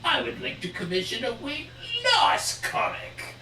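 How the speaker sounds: slow, whispery villain with wild pitch swings; creepy meme madman, drawn out; Joker. Acted. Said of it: "The, the Joker with the memes".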